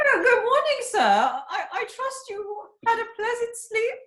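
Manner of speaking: high, hammed-up falsetto lady's voice; a man squeaking a woman's voice, laughing through it; badly faked wife. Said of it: "disguised badly as his wife".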